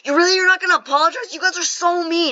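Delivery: put-on high, loud whiny little kid; tantrum imitation. Five-year-old refused an apology, mock tantrum. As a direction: mimic a small child throwing a fit. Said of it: "he starts giving a fit".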